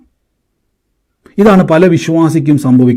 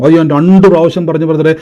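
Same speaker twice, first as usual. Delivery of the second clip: ordinary talk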